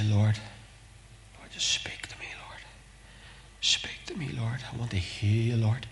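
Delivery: hushed, breathy plea to God; whispered prayer. Shown as a demonstration. Preacher showing prayer in the quiet place, whispered. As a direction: pray it softly, almost whispering.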